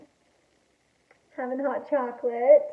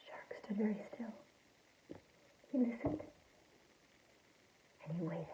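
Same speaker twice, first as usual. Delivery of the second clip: near-whispered narration; hushed suspense; 3 semitones lower, 19 dB quieter than usual. A narrator's reading. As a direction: drop to a hush, as if listening. Suspenseful listening in story.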